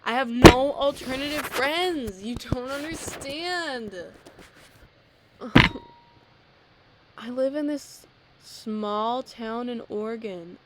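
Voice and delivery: theatrical loud complaint; dramatic lament. Real, not acted. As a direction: wail it like a tragedy.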